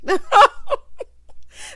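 loud, high burst of laughter; real laugh. Real, not acted. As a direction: crack up, high and helpless. Cracks up at the story.

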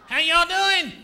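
big shouted howdy; loud greeting. Shown as a demonstration. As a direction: belt it out to the crowd. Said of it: "Watch this".

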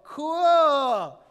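put-on a child delighted by his present; excited kid. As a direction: burst out like a kid unwrapping a gift. Kid opening a soccer ball.

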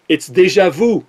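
déjà vu in a put-on French accent. Shown as a demonstration. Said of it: "with my French accent".

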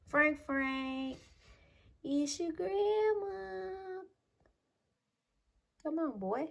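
high, slow and soft calling; soft pet coax. Real, not acted. Grandma softly coaxing Frank to come, high and slow. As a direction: draw the name out sweetly.

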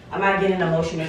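ordinary delivery

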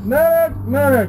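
shouting for a medic; man down shout. Real, not acted. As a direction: yell it across the field. Calling for medic.

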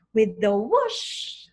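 breathy whooshing wind effect; whoosh sound. Acted. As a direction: blow it out like rushing wind. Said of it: "And then, with a whoosh".

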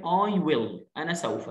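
usual speaking voice

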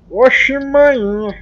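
loud, drawn-out imitation Baiano accent; mock Baiano accent. Vlogger teasing his Baiano friend, hammed-up Baiano accent. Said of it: "eu imito baiano".

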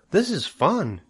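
high-pitched, chirpy delight; cheering up. A narrator's reading. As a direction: coax a friend into enjoying it. Cheering a nervous friend up.

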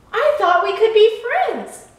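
high, loud, hopeful plea; earnest protest. A narrator's reading. Lighthouse appealing to the unfriendly boat, earnest protest.

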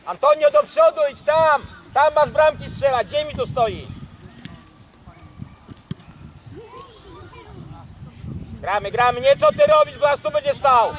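loud, exasperated sideline yelling; angry shouting. Real, not acted. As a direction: tell him off across the pitch. Coach scolding player from sideline.

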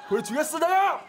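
hype shout. Pretend. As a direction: shout a challenge. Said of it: "틀어주시기 바랍니다".

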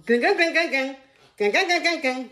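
loud, high ringtone noise; imitated phone ringing. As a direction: rattle off a shrill-pitched phone ring.

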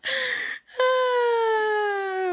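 long, high, whiny sigh; overwhelmed sigh. Real, not acted. Looking at all the trash to clean up, a long whiny sigh. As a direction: sigh at the mess you must clean. Facing a messy room.

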